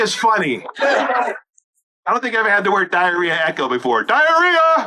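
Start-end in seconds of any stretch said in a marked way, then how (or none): 4.0-4.9 loud shout. Acted. Comic testing the echo, shouting the word with a laugh.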